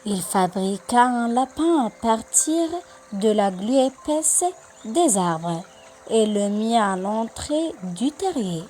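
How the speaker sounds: ordinary delivery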